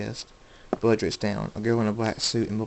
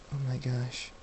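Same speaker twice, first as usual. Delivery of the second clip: hushed shock; 3 semitones higher, 10 dB quieter than usual. A narrator's reading. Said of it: "her hand over her mouth".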